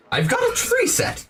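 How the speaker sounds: a very high, bouncy insect merchant; grasshopper shopkeeper. Acted.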